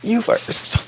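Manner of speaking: high, half-voiced threat; mock menace. [name] picking his first dragon victim, mock menacing. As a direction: tease it out high and airy.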